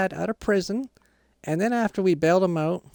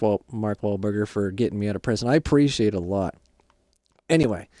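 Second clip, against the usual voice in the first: low, deeper dad character voice; 5 semitones lower than usual. Acted. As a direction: drop low into the dad role. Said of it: "now my dad can talk".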